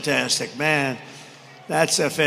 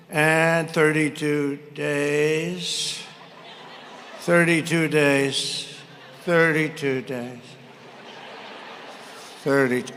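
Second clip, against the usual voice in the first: slow, halting mock of someone stuck on a line; mocking a stuck reading; 2 semitones lower, 6 dB quieter, much slower than usual. Mocking Kamala stuck at the teleprompter, slow halting repeat. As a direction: drag the words out like a broken teleprompter read. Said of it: "She goes".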